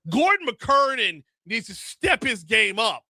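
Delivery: normal talking voice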